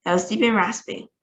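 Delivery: usual speaking voice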